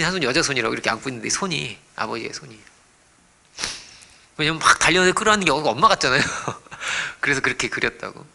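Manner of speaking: ordinary talk, nothing marked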